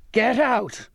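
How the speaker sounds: high, drawn-out furious order; enraged outburst; raging shout. A narrator's reading. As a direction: shout them out of the room. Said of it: "he raged".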